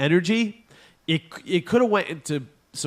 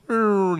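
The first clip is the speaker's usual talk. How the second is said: spooky rumble; 7 semitones higher, 4 dB louder than usual. Retold or imitated. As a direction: rumble it like a haunted house. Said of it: "you hear the sound".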